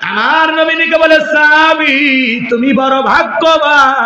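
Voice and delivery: high, loud, slowing shout; preached proclamation. Acted. Preacher voicing the Prophet praising the companion, loud high shout.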